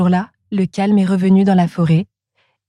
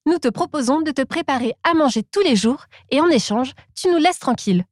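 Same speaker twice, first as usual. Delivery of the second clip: higher, light little monkey, a touch pleading; Monkey's high voice; 6 semitones higher than usual. Acted. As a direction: lift it up as the monkey making a deal.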